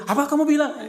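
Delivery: high-pitched indignant challenge; mock angry. Wife reacts to husband praising another woman, mock angry. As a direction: act out an offended wife, pitch jumping up. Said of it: "Dia marah dia".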